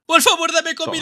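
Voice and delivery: high-pitched begging whine; hungry, high plea; pleading. Pretend. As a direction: beg for food in a high voice. Hungry player begs for food.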